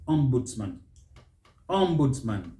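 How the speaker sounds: "Ombudsman" as OM-budz-mun; first-syllable stress. Shown as a demonstration. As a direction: hit OM in "Ombudsman". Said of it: "Stress is on that first syllable".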